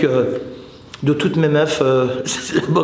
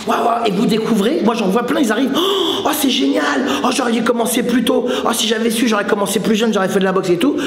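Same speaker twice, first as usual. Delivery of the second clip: high, breathless mock wonder; gushing newcomer; 5 semitones higher than usual. Coach mimicking newcomers thrilled by boxing, gushing. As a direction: imitate a wide-eyed beginner gushing.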